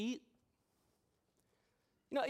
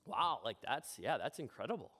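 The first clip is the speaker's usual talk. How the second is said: low, flat-toned mock "wow"; lower, unimpressed half-hearted praise; 8 semitones lower than usual. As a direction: imitate a bored listener. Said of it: "the person's just like".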